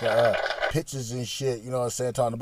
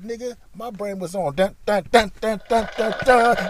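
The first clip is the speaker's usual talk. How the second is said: chanted dun dun getting louder; building dun dun alarm; 8 semitones higher, 6 dB louder than usual. Retold or imitated. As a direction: chant the alarm tune rising. Brain alarm sound effect.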